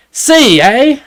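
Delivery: mock Canadian accent. A guy pulling letters from a bag, in a mock Canadian accent. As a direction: play up a jokey Canadian drawl on the eh. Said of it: "he's like".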